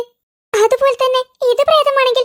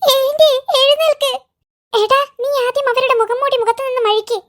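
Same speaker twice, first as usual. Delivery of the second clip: normal talking voice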